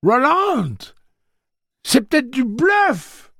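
high, loud, frantic yell. A narrator's reading. Eddie warning Roland of a bluff, yelling. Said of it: "hurla Eddie".

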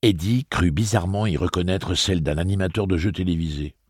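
ordinary delivery

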